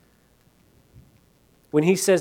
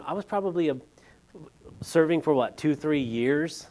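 ordinary talk

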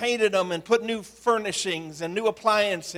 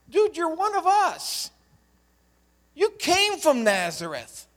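higher, mocking hometown heckler; scornful townsman; 6 semitones higher than usual. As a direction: tease him as a local who knew him. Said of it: "all of a sudden somebody says".

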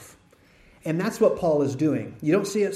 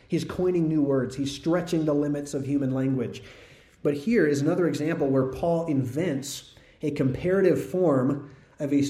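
ordinary delivery

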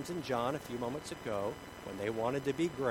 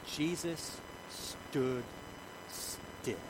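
very slow, softer, with pauses; dramatic slowing; 7 dB quieter, much slower than usual. A narrator's reading. Preacher revealing that Jesus stopped, slow and hushed. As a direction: let each word hang, dropping to a hush.

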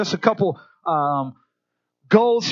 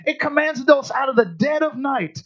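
ordinary talk, nothing marked

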